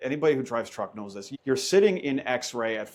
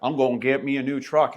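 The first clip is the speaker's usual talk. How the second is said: put-on Southern accent; 2 semitones higher, 4 dB louder than usual. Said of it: "he'd start sporting a Southern accent".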